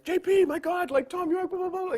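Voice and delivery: high, loud, breathless excitement; frantic fan phone call; excited gush. Retold or imitated. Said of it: "So I'm like".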